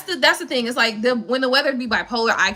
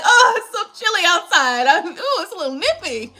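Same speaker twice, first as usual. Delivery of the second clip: mock shivering Floridian; 5 semitones higher, 3 dB louder than usual. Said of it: "that's when I be like".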